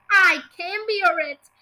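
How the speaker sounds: loud, slow cry of dismay. A narrator's reading. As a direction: cry it out, drawing the words out. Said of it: "cried Missus Twit".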